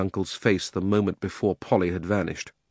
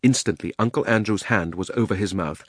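ordinary delivery

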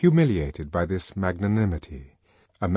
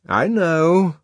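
conceding; 9 semitones higher, 6 dB louder than usual. A narrator's reading. Friend concedes Bernard's warning.

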